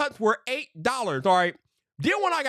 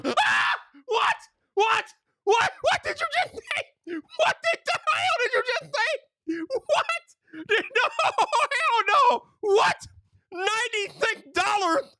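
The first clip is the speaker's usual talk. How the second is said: shocked shouting; slower than usual. Retold or imitated. Customer hearing the price, shocked high shouting. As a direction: scream at the bill in disbelief. Reacting to the ninety-six dollar bill.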